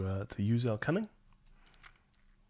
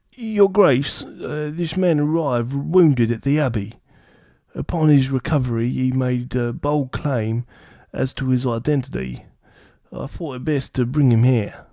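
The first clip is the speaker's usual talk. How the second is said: peasant voice; 2 semitones higher, 12 dB louder than usual. Acted. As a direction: plead like an anxious peasant. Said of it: "our sort of peasant voice".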